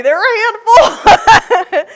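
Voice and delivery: a playful God warning she's got her hands full; giggling mock divine remark; laughing as God. God's voice in a retold story.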